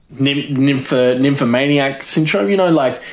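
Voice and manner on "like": ordinary talk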